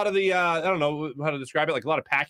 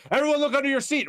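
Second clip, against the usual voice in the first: high, excited prank reveal; mock announcement; 12 semitones higher, faster than usual. Imitating co-host springing a prank at show's end, excited call-out.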